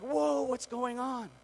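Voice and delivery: high, drawn-out startled cry; stretched, high-pitched "whoa" of surprise; acted alarm. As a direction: play him caught off guard by the lurching boat. Acts his alarm as boat speeds off.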